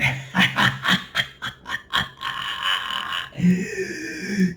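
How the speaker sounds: high cackling villain laugh; mock evil laugh; evil cackle. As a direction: cackle like a gleeful demon.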